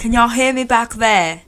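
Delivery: mock British accent as he checks that the people at the back can hear him. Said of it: "Can you please stop talking in a British accent".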